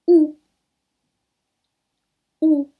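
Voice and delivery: "U" with pursed lips, French u. Shown as a demonstration. Teacher models pursed French "U" sound. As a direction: round lips tight for "U". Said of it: "o biquinho".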